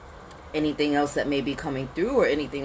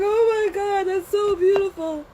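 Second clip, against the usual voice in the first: much higher, louder, gushing and tearful-sounding; gushing emotional self; 5 dB louder than usual. Retold or imitated. Imitating herself welling up at beautiful things, gushing. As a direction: melt over something beautiful, overcome. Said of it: "I'm like".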